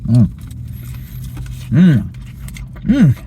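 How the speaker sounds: usual speaking voice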